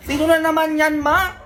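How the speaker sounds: high, loud whiny protest. Acted. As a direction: complain like a sulky grown child.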